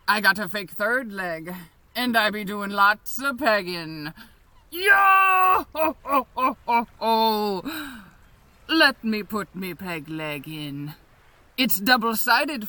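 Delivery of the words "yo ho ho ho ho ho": shouted pirate laugh, fading down. Acted. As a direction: bellow a hearty yo-ho-ho.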